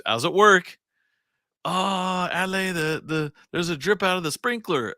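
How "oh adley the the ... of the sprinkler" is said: mom fretting in a lightly higher voice; a hint of a worried mother calling about a leak; lightly voiced fussing mother. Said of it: "one time she's".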